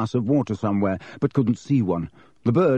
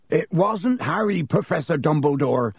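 Hagrid, urgent; 6 semitones higher than usual. A narrator's reading. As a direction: burst in begging to be heard. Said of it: "said Hagrid urgently".

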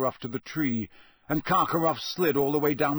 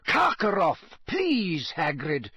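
sharp; 5 semitones higher, slower than usual. A narrator's reading. Dumbledore prompting Hagrid to go, sharply. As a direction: cut in sharply to correct him. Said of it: "said Dumbledore sharply".

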